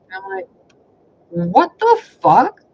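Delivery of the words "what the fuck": "What the fuck?" stretched slow, much higher and louder; a drawn-out, high, loud "What the fuck?"; shocked yell. Retold or imitated. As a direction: blurt it out in outraged disbelief. Dog pisses as he watches.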